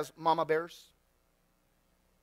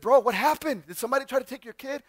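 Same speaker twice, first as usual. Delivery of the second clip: retold urgent alarm; 6 semitones higher, 5 dB louder than usual. Retelling urgent question to bloodied neighbor.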